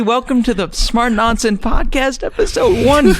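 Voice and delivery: high, slow, booming show intro; hype announcer voice. Pretend. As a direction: announce it like a game show host. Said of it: "Make the noise".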